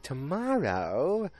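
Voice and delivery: slow high disbelieving rise; surprised. Retold or imitated. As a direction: stretch it out, shocked. Betty surprised at the appointment.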